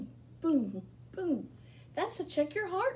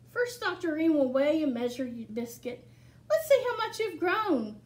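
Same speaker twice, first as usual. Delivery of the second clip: bright, lively picture-book reading; reading to children; 4 dB louder than usual. Acted. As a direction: read it to a room of small kids. Reads picture book aloud.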